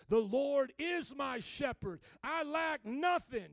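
slow, loud, emphatic proclamation; declaring in faith. Shown as a demonstration. Preacher showing the congregation how to pray, declaring boldly. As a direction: proclaim it firmly, stretching each word. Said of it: "praying like you declaring the truth".

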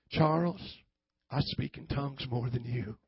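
low Southern preacher twang; mock Southern twang. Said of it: "with a little twang on it".

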